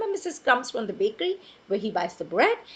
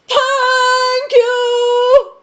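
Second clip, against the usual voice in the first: huge, loud, high sung thank you; booming thank you; 12 semitones higher, 15 dB louder than usual. Acted. Mister Noisy booming thanks at the baker. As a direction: bellow it like a giant. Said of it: "you know how its thank you would sound".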